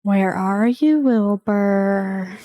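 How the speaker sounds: a far-off voice calling out the name, drawn out; eerie calling voice. Acted. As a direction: call to him from somewhere unseen, lightly voiced. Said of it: "a voice calling out to you".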